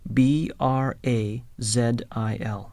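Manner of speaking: spelling out Brazil for a listener; spelling letter by letter. Shown as a demonstration. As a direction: spell B R A Z I L out, one letter at a time. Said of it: "where is he from?".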